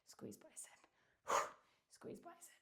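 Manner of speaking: whispered cue through effort; barely voiced, straining; strained whisper. Real, not acted. Straining through bicep curls.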